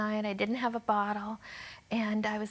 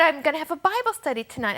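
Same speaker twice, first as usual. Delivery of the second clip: bright invitation; 6 semitones higher, 5 dB louder, faster than usual. Retold or imitated. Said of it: "she said".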